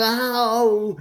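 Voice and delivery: a sharp high mock "Ow!"; retold high yelp. Retelling a friend's cry of pain, high yelp. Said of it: "Court hollered".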